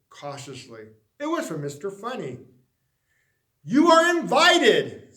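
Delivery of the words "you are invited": loud, higher, slow invitation; grand announcement. Acted. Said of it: "said the letter".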